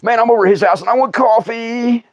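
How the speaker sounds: ordinary talk, nothing marked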